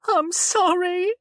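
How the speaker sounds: high, slow tearful apology; sobbing. A narrator's reading. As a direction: sob the apology out. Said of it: "she sobbed".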